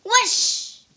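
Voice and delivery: loud, high rushing whoosh; a blast of magic fire; whoosh sound. Acted. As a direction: make the fire burst.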